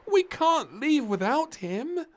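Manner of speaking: high, loud and slow; insist, no arguing; insistent child. Acted. Little Princess refusing to leave Desmond, high insistent call.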